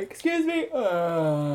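talking through a yawn; words stretched by a yawn. Real, not acted. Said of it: "Stop yawning".

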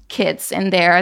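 ordinary talk

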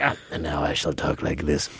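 low, soft, hushed mock menace; Aidan Gillen's screen voice. Host imitating the actor's hushed Littlefinger voice. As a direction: mimic a brooding actor's hush. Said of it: "he gets on set and he's like".

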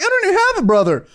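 high-pitched quick mock outrage; high indignant reply. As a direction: jump way up high and fast, mock protest. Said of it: "You're like".

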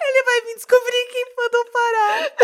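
a high voice breaking into laughter; laughing while quoting. Real, not acted. Quoting her hotel fear to friends, high giggling squeal. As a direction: squeal the words through giggles. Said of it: "laugh cry heard".

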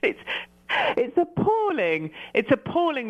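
teasingly appalled; mock horror. Real, not acted. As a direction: wail it in playful disapproval. Mock outrage at his accent.

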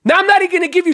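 retold furious yell, slowing into the insult; angry yell. Retold blow-up at Uber driver.

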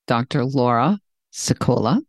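"Laura" stretched upward, pause, "Secola" set apart; up then down. Shown as a demonstration. Host trying out the guest's technique, name deliberately split. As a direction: carefully separate "Laura" and "Secola" with a lifted tune.

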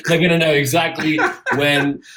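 words squeezed out through laughter; laughing through words. Real, not acted. Cohost describing trolley collectors, laughing through it. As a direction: talk while still laughing.

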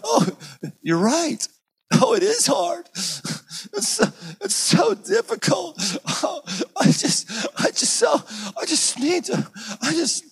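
weep along in exaggerated pity; mock sympathetic wail. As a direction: friends joining the pity party, mock tearful wail. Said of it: "people around us are like".

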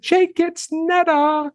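imitating Netta phoning him, high sing-song. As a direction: sing the name out high and sweet. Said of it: "she kinda talks in a sing-song voice like this".

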